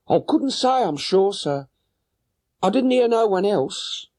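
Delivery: high voiced woman, a little anxious; worried boatman's wife. Boatman's wife telling Holmes her husband is gone, high anxious voice. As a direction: answer the gentleman with a high, fretful woman's voice.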